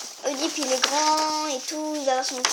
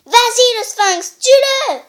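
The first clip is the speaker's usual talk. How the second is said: loud high chant; crowd cheering; 8 semitones higher, 7 dB louder, slower than usual. Acted. The audience cheering the sphinx, loud. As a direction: egg the sphinx on. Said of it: "Le public en chœur".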